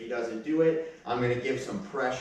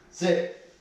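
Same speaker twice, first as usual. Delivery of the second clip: firm sit command; 6 semitones higher, 5 dB louder than usual. Real, not acted. Command to puppy on leash.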